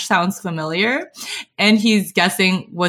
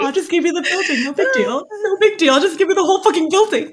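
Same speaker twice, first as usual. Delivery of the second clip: mocking simp; 9 semitones higher, faster than usual. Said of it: "Such a simp".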